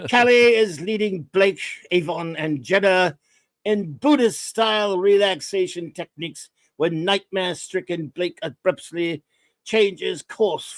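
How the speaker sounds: measured, drawn-out character accent; slow, deliberate delivery in a put-on actor's voice; Avon. Shown as a demonstration. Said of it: "I can do it as Avon".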